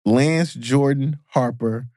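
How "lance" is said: ordinary talk, nothing marked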